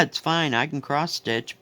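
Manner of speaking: normal talking voice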